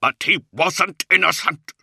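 high, loud, anguished protest; bitter cry. A narrator's reading. Luther rejecting the old master's comfort, crying bitterly. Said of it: "Luther cried bitterly".

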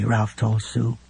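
usual speaking voice

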